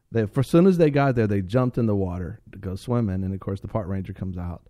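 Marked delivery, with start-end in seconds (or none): none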